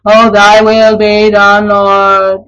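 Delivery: slow, droning mock prayer; mindless automaton. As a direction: recite it like a robot on autopilot. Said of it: "we're supposed to be automatons and go".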